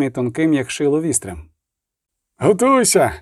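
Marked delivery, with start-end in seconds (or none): 2.4-3.1 higher-pitched command, moderately raised; shouted warning. A narrator's reading. Galada warning his men before the charge, shouting. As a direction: call the men to readiness. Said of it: "крикнув Галада".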